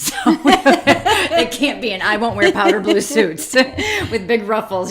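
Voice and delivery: giggling out the punchline; laughing. Real, not acted. Joking to her husband about pastor's wives, laughing.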